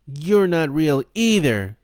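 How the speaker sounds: put-on high, loud, slow boy's defiance; strong as he can. A narrator's reading. Boy stands up to the devil, defiant. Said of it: "he said as strongly as he could".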